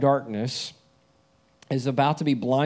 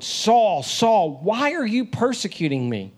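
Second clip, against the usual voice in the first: emphatic divine call; 7 semitones higher than usual. Shown as a demonstration. The Lord calling Saul on the Damascus road, emphatic raised call. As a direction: cry the name out twice with urgency, pitched up. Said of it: "it's emphatic".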